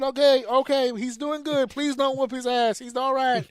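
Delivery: high, hurried mock plea; pleading teacher voice. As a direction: beg her not to punish him.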